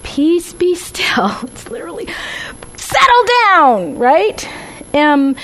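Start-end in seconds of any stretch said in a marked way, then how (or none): 0.0-1.3 gentle whisper. Shown as a demonstration. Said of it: "it's not a". 2.8-4.0 higher, slower stern order; firm rebuke. Retold or imitated. Jesus rebuking the storm.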